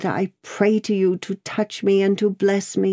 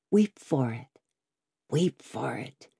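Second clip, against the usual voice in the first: low "weep for it", fading softer; tender, lowered urging; sinking plea; 4 semitones lower, 5 dB quieter than usual. Acted. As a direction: let the repeat drop to a hush. Daughter repeats plea, sinking softer.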